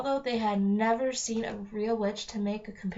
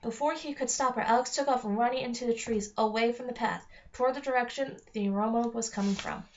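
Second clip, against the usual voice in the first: ordinary delivery, nothing marked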